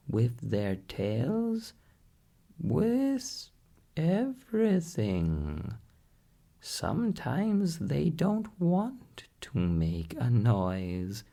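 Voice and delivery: high, squawky parrot voice, slow and patient; a wise parrot setting the doctor straight. A narrator's reading. Parrot correcting the doctor, in a high parrot voice.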